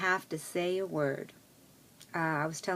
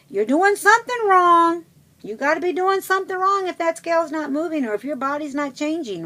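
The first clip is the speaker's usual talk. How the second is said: high, loud imitation of a know-it-all accuser; mock scolding; 10 semitones higher, 8 dB louder than usual. Critics blaming someone whose scale won't move, mocking high-pitched scolding. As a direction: mimic the nagging critics, pitched way up. Voicing a scolding phrase often heard.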